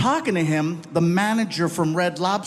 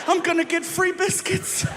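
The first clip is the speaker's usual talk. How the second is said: high, delighted squeal about biscuits; giddy excitement; 9 semitones higher than usual. Retold or imitated. Dad gushing after the manager leaves, high excited squeal. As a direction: squeal with greedy joy.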